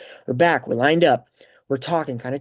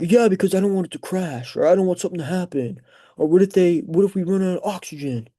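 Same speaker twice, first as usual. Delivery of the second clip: higher-pitched what-ifs; mock anxious worrying; 6 semitones higher than usual. Imagined fearful self arguing about planes, mock anxious. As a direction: play the worrier piling up fears.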